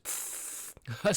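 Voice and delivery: voiceless puffed whoosh; imitated melting whoosh. Mimicking a plastic bottle melting through the floor, puffed whoosh. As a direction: blow out a puff of air as a sound effect. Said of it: "the whole plastic container just goes".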